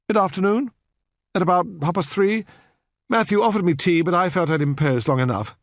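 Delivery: a Victorian lady making polite conversation; courteous, distant spinster; prim elderly lady. A narrator's reading. Miss Hunt answering the inspector.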